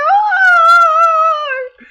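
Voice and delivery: very long, high scream; sustained starstruck shriek; re-enacted fan scream. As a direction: hold a loud, high "oh". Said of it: "I was like".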